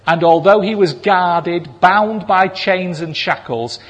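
normal talking voice

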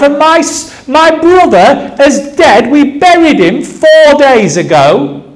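high-pitched, mounting exasperation; indignant protest. Acted. Bereaved Martha complaining that Jesus brought no food, indignant.

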